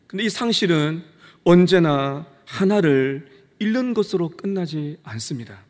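normal talking voice

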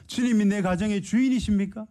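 high, ringing preacher's challenge; fervent preaching. Acted. Preacher challenging the congregation, ringing high cry. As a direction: call it out to the whole church.

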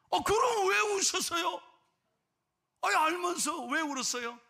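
loud, high incredulous cry; shocked outburst. Retold or imitated. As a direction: burst out in disbelief. Pastor shocked at grandmother.

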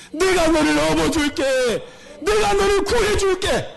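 put-on high, pleading voice of God calling his child. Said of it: "하나님 그렇게 말씀하시는 거예요". (아담에게 돌아오라 간청하는 하나님의 높은 목소리)

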